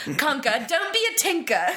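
imitating a British landlady, high and bright on "don't be a tinker"; British accent. Imitating a British landlady scolding the dog, British accent. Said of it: "would be like".

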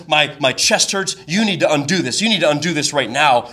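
usual speaking voice

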